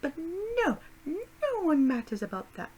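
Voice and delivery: high, loud, theatrical complaint; mock dramatic lament. Real, not acted. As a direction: wail it like a soap star.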